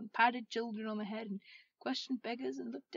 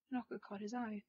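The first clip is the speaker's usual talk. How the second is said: plain reading voice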